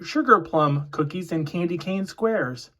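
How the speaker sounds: ordinary delivery, nothing marked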